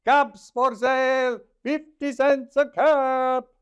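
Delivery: high, loud peddler's call; calling out wares. A narrator's reading. Peddler calls to the street, high loud cry. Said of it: "he called".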